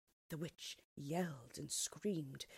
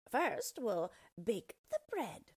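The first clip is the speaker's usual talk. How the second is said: slow, higher sly witch voice; scheming witch; 6 semitones higher, 3 dB louder, slower than usual. Acted. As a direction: drawl it with hidden menace. Witch plots aloud.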